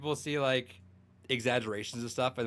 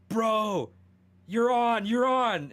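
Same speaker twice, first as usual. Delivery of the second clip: high, loud urgent call from a friend; excited retold call; 11 semitones higher, 6 dB louder, much slower than usual. [name]'s friend calling to tell him he's on the track, excited and loud. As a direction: blurt the news up high like a buddy on the phone.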